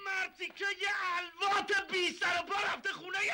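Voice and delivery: outraged shout. Acted. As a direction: yell it at the news. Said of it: "داد نزن". (فریاد خشمگین شوهر)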